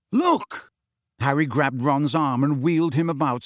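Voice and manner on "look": sharp, high-pitched urging; sudden insistent exclamation; urgent. A narrator's reading. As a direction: cry out in frustration. Harry urges Ron to look.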